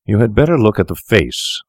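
usual speaking voice

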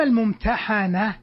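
الممتحنة with an a after the ح; fatha pronunciation. Shown as a demonstration. Teacher turning to the passive form, الممتحنة with fatha, "-hana". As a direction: pronounce الممتحنة as al-mumtahana, passive participle. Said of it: "بالفتح".

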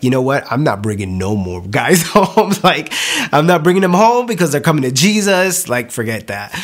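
mock outrage, laughing it off; playful exasperation. Joking protest about guys converting.

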